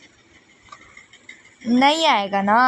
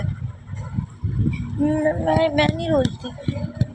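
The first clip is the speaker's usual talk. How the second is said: tearful denial; 3 semitones higher, 4 dB quieter, much slower than usual. Acted. Denies crying after being scolded.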